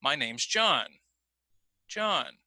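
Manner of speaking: "John" carries the main pitch change, rising then falling; focus on John. Shown as a demonstration. Teacher shows class focus word stress. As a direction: lean on "John" and let the voice drop. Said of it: "John suddenly becomes the most important word".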